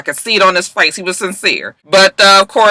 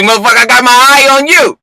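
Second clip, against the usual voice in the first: higher, louder warning; mock threatening glare; 6 semitones higher, 5 dB louder than usual. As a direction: threaten him with your eyes.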